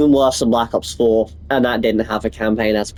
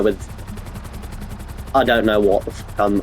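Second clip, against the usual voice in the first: normal talking voice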